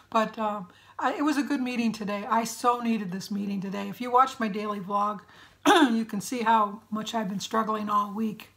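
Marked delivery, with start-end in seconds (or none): none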